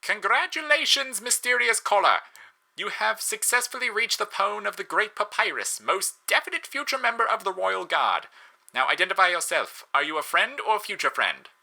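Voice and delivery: very high, loud, blaring Papyrus voice; kazoo yodel voice. Acted. Papyrus greets the mystery caller like a kazoo yodelling. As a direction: honk it like a kazoo trying to yodel. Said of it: "like a kazoo attempting to yodel".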